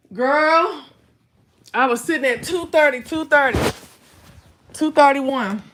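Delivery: loud, emphatic weight brag; loud excited reveal. Real, not acted. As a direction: belt out the numbers proudly.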